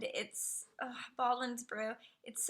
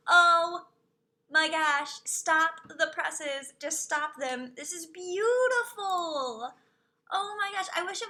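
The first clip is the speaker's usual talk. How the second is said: loud, slow, awestruck exclamation; excited gushing; 3 semitones higher, 7 dB louder than usual. Real, not acted. To stream chat over a gorgeous dragon skin, loud awed gushing. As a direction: stop everything, it's stunning.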